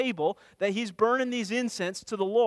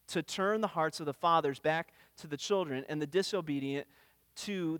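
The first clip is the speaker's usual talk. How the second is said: a softer, measured reading of scripture; 4 semitones lower, 3 dB quieter than usual. Acted. Preacher reading Luke's verses to the congregation, softly reverent. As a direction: read the verses with quiet reverence.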